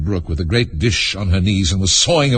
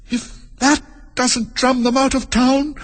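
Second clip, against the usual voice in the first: put-on very high, slow girl's voice; high-pitched storybook Alice; dismayed girl; slower than usual. A narrator's reading. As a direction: think aloud as a small girl.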